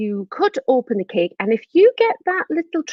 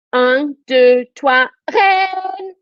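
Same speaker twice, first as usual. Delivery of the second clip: counted-in shout; 5 semitones higher, 4 dB louder, much slower than usual. Shown as a demonstration. Teacher shouting along with children on three, counted-in shout. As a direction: count "trois", then "reine" high and held. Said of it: "I want you to shout".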